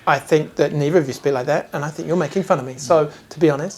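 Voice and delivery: ordinary talk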